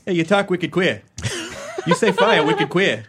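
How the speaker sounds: laughing Boston bully, "wicked queer" with dropped r; Boston accent. Acted. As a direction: tease in a thick Boston accent. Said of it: "insult people with a Boston accent".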